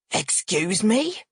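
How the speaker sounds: slow, incredulous demand. A narrator's reading. Father hears himself accused of killing, demanding. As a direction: draw it out in affronted disbelief. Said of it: "Tel demands".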